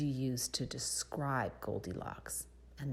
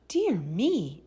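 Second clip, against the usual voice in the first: higher, louder dismayed exclaim; a storybook cry of dismay; startled exclamation; 5 semitones higher, 7 dB louder than usual. A narrator's reading. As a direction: exclaim it in mild shock. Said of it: "exclaimed Goldilocks".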